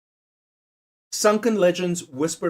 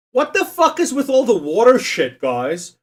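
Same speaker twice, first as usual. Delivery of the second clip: louder, higher fed-up outburst at the quiz; exasperated rant; 7 semitones higher, 4 dB louder than usual. Real, not acted. Streamer ranting at the quiz's water options, exasperated and loud. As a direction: throw up your hands at the quiz.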